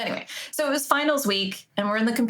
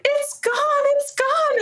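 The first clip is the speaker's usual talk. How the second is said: high, loud hammed-up lament; fake panic; 6 dB louder, much slower than usual. Pretending innocence in the lab, high loud mock lament. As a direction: fake despair loudly. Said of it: "And I was like".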